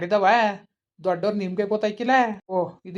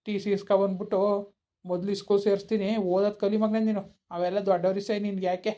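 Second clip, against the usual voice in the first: normal talking voice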